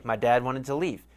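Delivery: ordinary talk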